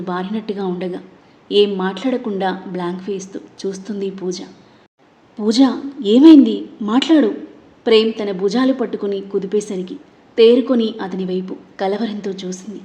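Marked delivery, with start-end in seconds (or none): 5.4-7.3 sudden loud worried plea; worried urgent call. Acted. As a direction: shake her out of it with your voice. Shaking her, urgent worried call. (భయంతో గట్టిగా పిలుపు)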